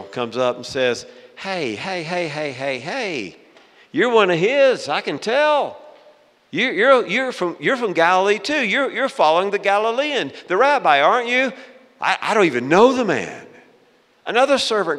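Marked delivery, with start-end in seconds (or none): 1.4-11.5 high, excited, insistent accuser; accusing servant girl. Acted. Servant girl spotting Peter as a follower, high accusing voice. 12.0-13.5 loud, higher, stumbling protest; flustered denial. Acted. Peter denying Jesus to a servant girl, flustered loud denial.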